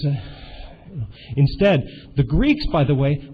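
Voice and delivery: ordinary delivery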